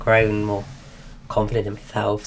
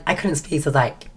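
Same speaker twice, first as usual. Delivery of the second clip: ordinary delivery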